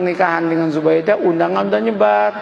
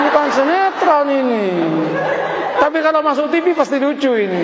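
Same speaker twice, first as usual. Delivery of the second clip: laughing aside; 6 semitones higher than usual. Real, not acted. Joking aside to the congregation.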